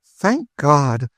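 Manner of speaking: mild outburst of thankful joy; relieved exclamation. A narrator's reading. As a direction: let the relief burst out. Sister hears brother escaped.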